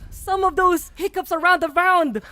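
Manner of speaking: loud, high hype call; excited shouting. Real, not acted. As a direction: ride the hype up. Caster hypes clutch round.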